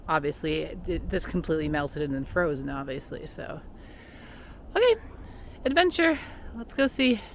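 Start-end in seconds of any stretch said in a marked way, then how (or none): 4.8-7.2 excited. Real, not acted. Heading off to explore.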